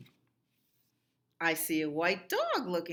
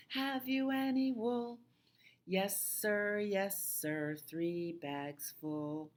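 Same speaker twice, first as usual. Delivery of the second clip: lilting nursery-rhyme recitation, higher on the questions; 5 semitones higher, slower than usual. Acted. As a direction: recite it to little ones in a singsong.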